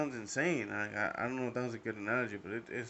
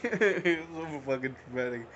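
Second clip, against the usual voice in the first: laughing; 4 semitones higher, 7 dB louder than usual. Real, not acted. Laughs while retelling trauma.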